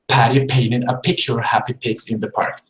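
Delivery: aspirated P through Patty painted pigs; explosive English P. Shown as a demonstration. Teacher finishing the P drill. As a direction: puff air on each P. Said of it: "pronounce P's a little bit stronger".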